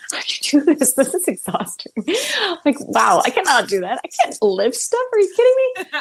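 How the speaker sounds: hammed-up disbelief at heavy lifting; mock exasperated. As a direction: play up how helpless you felt. Retelling exhaustion on grip job.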